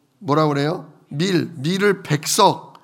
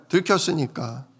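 usual speaking voice

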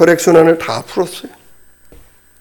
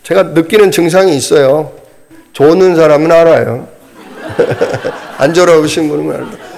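ordinary talk

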